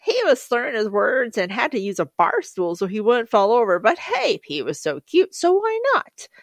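mock response. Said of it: "And my response is".

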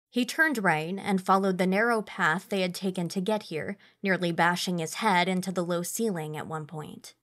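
ordinary talk, nothing marked

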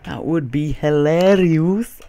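high, slow, drawn-out goofy voice; silly put-on voice. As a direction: stretch it out high, rising at the end. Silly echo of his own remark.

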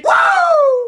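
very high, long whoop; celebratory whoop. Real, not acted. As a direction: let out a piercing victory woo.

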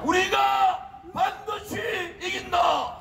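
rally cry, shouted slow and high; roaring rallying speech. Real, not acted. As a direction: roar it to the crowd. Leader chants, crowd echoes.

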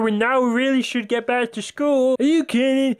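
high, silly schoolkid voice; put-on game character voice. Schoolkids arguing about going back, high put-on voice. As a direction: pitch it right up, hammed-up. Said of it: "I'm gonna stop doing that".

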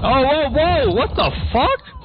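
high, startled exclamation. Real, not acted. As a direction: jump in shock. Sudden surprise in game.

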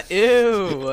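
loud laughing ew; laughing disgust. Real, not acted. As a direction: squeal ew through laughter. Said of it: "A S M R".

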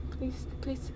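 whispered pleading; breathless hushed begging; 1 whispered plea. Real, not acted. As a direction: whisper a desperate please. Begging the Pokemon to stay caught.

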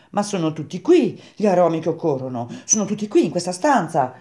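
high, emphatic apprentice voice; croaking Grenouille, insistent. Acted. Grenouille telling Baldini all scents are here, insistent.

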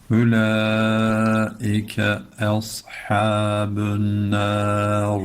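very slow, sung tajweed chant; Quran recitation. Shown as a demonstration. Teacher chanting the verse for students, very slow. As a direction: draw out every syllable in chant.

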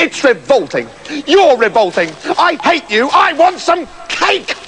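bratty princess tantrum rising in pitch; building tantrum. Acted. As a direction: start sulky, end in a high wailing demand.

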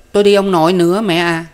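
put-on high, loud little child; small child's voice. A narrator's reading. Toddler begs mother to go along to grandfather's, small child's voice. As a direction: pipe up like an eager toddler. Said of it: "một đứa trẻ tuổi mới lên ba".

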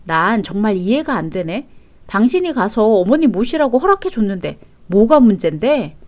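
usual speaking voice

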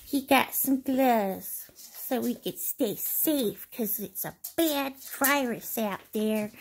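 ordinary delivery

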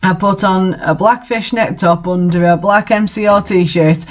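ordinary talk, nothing marked

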